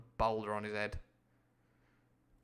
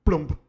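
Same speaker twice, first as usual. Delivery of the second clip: plump sound effect; 4 semitones higher, 13 dB louder than usual. Shown as a demonstration. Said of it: "Very bad news".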